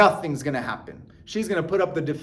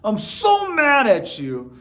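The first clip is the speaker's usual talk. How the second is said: a performed mad complaint, much higher; mock angry outburst; 5 semitones higher, much slower than usual. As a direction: snap at your partner, hammed-up. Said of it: "this is".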